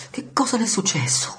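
high, alarmed question; frightened cry. A narrator's reading. Martin cries out to grandpa, frightened and high. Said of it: "urlò Martin spaventata".

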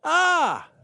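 high, loud welcoming exclamation; exclaiming greeting. Acted. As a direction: greet them with a bright exclamation. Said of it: "the doctor exclaimed by way of greeting".

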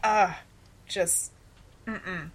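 usual speaking voice

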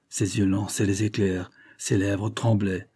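usual speaking voice

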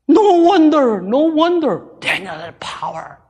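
loud, high, fervent sermon; full-force preaching. Real, not acted. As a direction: drive it home to the whole congregation.

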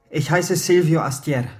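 loud, firm declaration; bold confession. Acted. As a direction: blurt out the true name with force. Geständnis des wahren Namens. (lautes, festes Geständnis)

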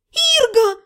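high cry of a name; calling out. Acted. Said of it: "закричала я". (закричала высоко)

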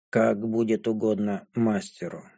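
low, soft voice of a resigned servant; impassive, submissive dragon girl. A narrator's reading. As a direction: answer the master quietly, without interest.

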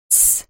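hushing hiss; sharp shh to silence. Acted. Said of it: "зашипела".

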